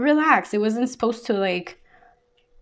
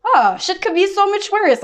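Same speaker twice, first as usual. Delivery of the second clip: much higher and slow, airy and dismissive; mock breezy reassurance; 10 semitones higher, 4 dB louder, slower than usual. Reader quotes a friend shrugging off someone's drama, high and slow. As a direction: float the line up high, drawn out.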